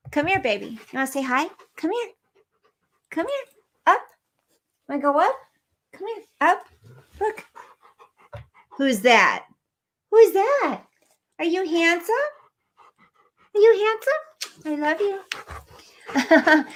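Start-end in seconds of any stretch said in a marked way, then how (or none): 0.1-7.4 high, sing-song coaxing pet voice. Real, not acted. Streamer calling her son's dog onto her lap, high baby talk. 10.1-16.6 much higher doting pet voice, drawn out at the end. Real, not acted. Streamer showing off the dog to viewers, cooing baby talk.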